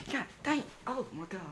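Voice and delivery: small, high, stunned voice; stunned reaction. Acted. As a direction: softly squeak it out, as if just slapped. Acted reaction to being slapped.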